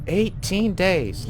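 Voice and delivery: drawn-out shocked cry; surprised outburst. Real, not acted. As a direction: stretch it out in disbelief. Shocked at long mission time.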